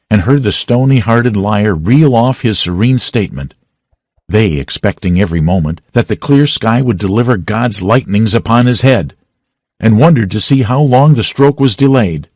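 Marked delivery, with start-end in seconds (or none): none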